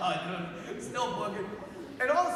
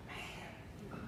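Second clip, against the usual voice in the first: awed whisper; 14 dB quieter than usual. Retold or imitated. Priest after the man winks and smiles, awed whisper. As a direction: breath-only murmur of wonder.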